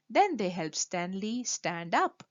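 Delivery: ordinary delivery, nothing marked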